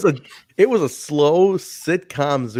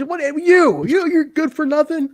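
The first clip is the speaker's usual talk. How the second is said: much higher, hammed-up sneer; mock insult. Host voicing Sanders insulting Holly, much higher. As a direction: belittle her in a silly voice.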